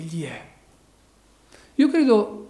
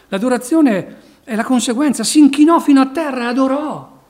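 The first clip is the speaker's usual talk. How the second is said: preaching at full force; 7 semitones higher, 10 dB louder than usual. Acted. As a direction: drive each phrase home like a revival preacher. Preacher exhorts congregation.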